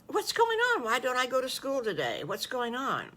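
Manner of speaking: put-on high, puzzled child voice; confused little wolf. Little wolf at the farmers' door asking why no school, high and puzzled. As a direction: ask it like a small boy who doesn't understand.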